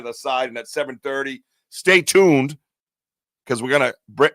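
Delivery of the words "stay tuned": loud announcer sign-off, pitched up; radio voice. Pretend. Host teases a surprise to viewers, radio voice. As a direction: punch it like a radio promo.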